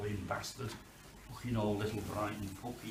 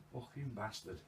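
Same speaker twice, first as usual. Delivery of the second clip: ordinary delivery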